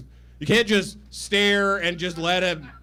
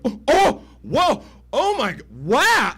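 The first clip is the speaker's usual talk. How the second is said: high, loud gasping wonder; imitating his girlfriend's stunned awe; mock amazement; 7 semitones higher, 5 dB louder than usual. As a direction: gush like she's blown away. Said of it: "she's going".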